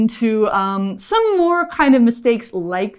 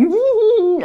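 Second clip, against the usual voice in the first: giggle; 12 semitones higher than usual. Shown as a demonstration. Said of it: "make you giggle".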